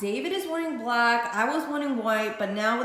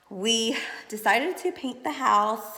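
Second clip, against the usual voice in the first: normal talking voice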